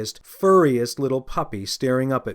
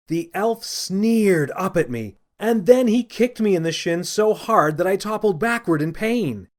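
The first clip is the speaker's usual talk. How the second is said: ordinary delivery, nothing marked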